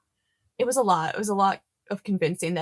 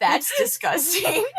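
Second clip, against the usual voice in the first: slow, laughing through disgust; 10 semitones higher, 3 dB louder, slower than usual. Real, not acted. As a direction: giggle out the words slowly. Laughing at gross joke.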